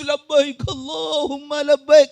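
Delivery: high, slow wailing pilgrim chant; mock weeping. Preacher imitates a weeping pilgrim at prayer, mock tearful chant. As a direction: draw it out as if tearfully praying.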